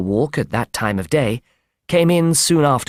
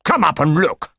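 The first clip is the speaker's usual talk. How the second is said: higher, agitated command in character; acted shout; 4 semitones higher than usual. A narrator's reading. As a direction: order it urgently, without full volume. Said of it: "he shouted".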